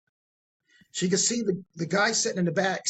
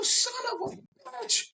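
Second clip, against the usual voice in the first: high mock curse. Teller learns nothing was recorded.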